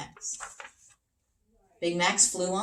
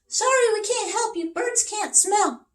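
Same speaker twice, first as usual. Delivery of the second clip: high, loud birdlike chorus voice; high birds voice; chirpy birds; 8 dB louder than usual. Acted. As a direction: sing it out as a flock of birds. Birds voiced in a read story.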